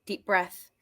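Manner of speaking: ordinary delivery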